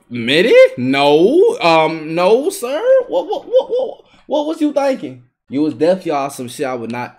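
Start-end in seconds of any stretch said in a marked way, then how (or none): 2.1-5.2 high, falsetto what-what-what with a laugh; mock squeaky disbelief. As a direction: squeal the whats in mock shock. Mocking opponent's bad play.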